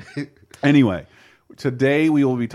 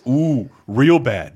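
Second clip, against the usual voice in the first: slow mock disapproval; much slower than usual.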